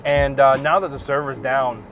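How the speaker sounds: normal talking voice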